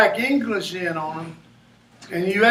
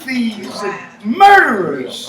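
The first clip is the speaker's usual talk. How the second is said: slow, loud and deliberate, hitting each noun; emphatic loud declaration; 3 semitones higher, 5 dB louder, slower than usual. Real, not acted. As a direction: drive home robbers, thieves and murderers. Emphatic outrage about argot.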